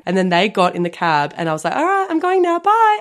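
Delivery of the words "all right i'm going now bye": high sing-out farewell, acted for the story; breezy retold goodbye. Retelling goodbye as friends get in cab, breezy. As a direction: call it out way up high.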